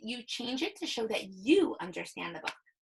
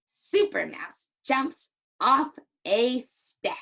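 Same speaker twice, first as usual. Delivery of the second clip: slow, loud, bouncy happy reading; big, cheerful storybook delivery; 5 dB louder, slower than usual. Shown as a demonstration. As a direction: punch each word happily. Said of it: "Use that big happy voice".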